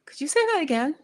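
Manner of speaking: high, loud, mock-polite plea to repeat; baffled polite request. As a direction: act out straining to understand someone. Acting out baffled request to locals.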